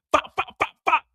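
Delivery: ordinary delivery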